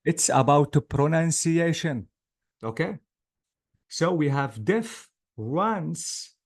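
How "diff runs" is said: "diff, runs" as two separated beats; two syllables. Shown as a demonstration. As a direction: leave a gap between "diff" and "runs". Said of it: "so two syllables".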